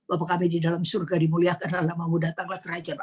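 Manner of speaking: a hurried, mocking recitation; rushed mock prayer. Preacher mocking a long prayer while sinking, rushed. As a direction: rattle the prayer off very fast. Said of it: "Dia nggak doa".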